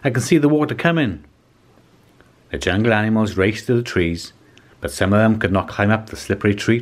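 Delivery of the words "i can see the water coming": much higher, sharp bird squawk; squawking cockatoo. A narrator's reading. Cockatoo urging the animals to flee, squawking. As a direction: squawk it like a parrot. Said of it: "squawked the cockatoo".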